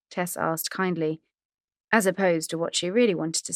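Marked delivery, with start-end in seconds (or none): none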